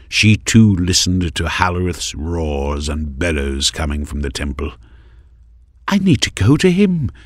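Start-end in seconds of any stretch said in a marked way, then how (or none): 5.9-7.1 high, urgent plea; pleading young woman. A narrator's reading. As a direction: beg to go to him. Maris pleads to leave.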